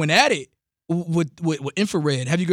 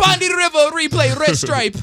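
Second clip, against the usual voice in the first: high, hammed-up fake Jamaican; badly faked Jamaican patois; terrible Jamaican accent; 10 semitones higher, 4 dB louder than usual. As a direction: shout out in a bad Jamaican accent. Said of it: "My Jamaican accent is terrible".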